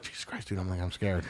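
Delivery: very low, hushed mutter; retold hushed aside. Retelling his aside to his friend, hushed and low. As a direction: drop it down as a secret confession.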